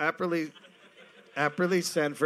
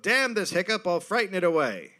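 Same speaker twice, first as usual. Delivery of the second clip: higher, louder mock old-timey declaration; hammed-up period voice; quoted old-timey drunk; 7 semitones higher, 5 dB louder than usual. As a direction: read the quote high and loud like a blustering gentleman.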